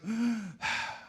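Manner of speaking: long outward breath sigh; breathy exhale as the divine name. Shown as a demonstration. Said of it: "practique usted cómo se pronuncia".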